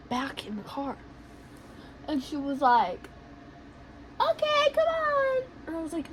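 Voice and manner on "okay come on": very high, breezy put-on voice. Woman calling friend back into car, high imitated voice. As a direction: chirp it way up high.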